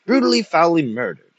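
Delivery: loud, high melodramatic outrage; an old man's theatrical cry; croaked accusation. A narrator's reading. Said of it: "croaked Iverson Lord at all of them".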